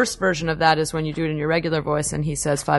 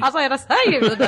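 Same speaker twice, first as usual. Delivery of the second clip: put-on high southern accent through laughter; hammed-up Southern twang; 7 semitones higher than usual. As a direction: imitate yourself going Southern, amused. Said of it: "See, it naturally lends itself".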